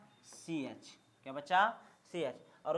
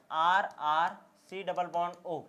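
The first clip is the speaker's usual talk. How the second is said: slow dictation; 8 dB louder, much slower than usual. Real, not acted. Teacher dictating a formula.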